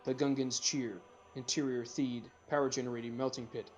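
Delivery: usual speaking voice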